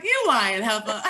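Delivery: mock sassy daughter, cracking up; laughing callout. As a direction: tease her for the obvious lie. Daughter calls out mom's lie.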